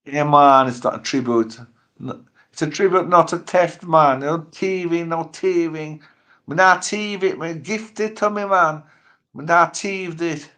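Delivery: high, loud put-on Caribbean patois; mock Caribbean patois. Host jokingly denying theft, in mock Caribbean patois. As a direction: protest in an exaggerated, high-pitched Caribbean patois.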